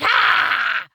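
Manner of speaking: loud high yell imitating him; imitated loud yell; mock shout. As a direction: bark it out as he did. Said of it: "he's like".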